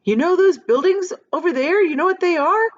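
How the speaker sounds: high, loud eager call; shouted with excitement. A narrator's reading. James shouting, jumping up and down with excitement. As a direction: bounce the words out, excited. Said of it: "shouted James".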